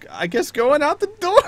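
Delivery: high, loud laughing narration; breaking into laughter. Real, not acted. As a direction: crack up as you describe it. Game master cracking up while narrating.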